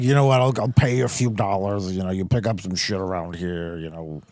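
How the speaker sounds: mock gruff boss, very low; low grumble, fading to a soft mumble; Darnell. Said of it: "He's like".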